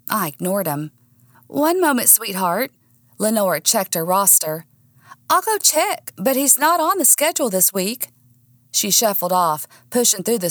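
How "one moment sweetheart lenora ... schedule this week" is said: high, sweet fake-friendly voice; sugary receptionist. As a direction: greet with syrupy politeness. Lenora voiced in story.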